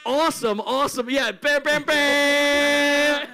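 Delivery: loud, high hype shout; excited. Real, not acted. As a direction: pump up the crowd with every name. Hyping up the tour lineup.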